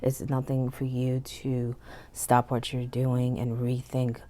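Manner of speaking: ordinary talk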